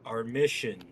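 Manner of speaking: ordinary delivery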